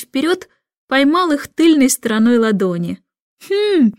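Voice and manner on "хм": cry out. A narrator's reading. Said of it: "закричал он".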